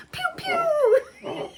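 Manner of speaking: high pew pew sound effect; mock web shooter. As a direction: zap like a superhero. Playful superhero shooting sound.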